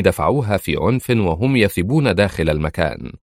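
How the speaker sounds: usual speaking voice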